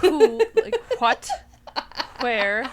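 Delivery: who, like, what and where each breathed with an H up front, a hint of the grandma; who, like, what, where, sounded hw as grandma does; light mock of grandma, an H blown into who, what and where; grandma's H before W. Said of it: "puts H's in W H words".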